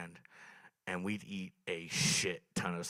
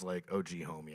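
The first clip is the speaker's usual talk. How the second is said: ordinary talk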